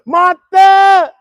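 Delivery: high, loud holler of a name; comic scream. Pretend. Host hollering for Martha, comic scream. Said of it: "I scream at Martha".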